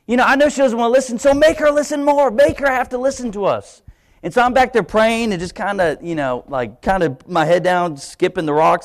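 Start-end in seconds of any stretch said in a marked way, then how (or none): 0.1-3.8 higher, insistent demand to God; prideful prayer. Retold or imitated. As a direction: push God to make her listen.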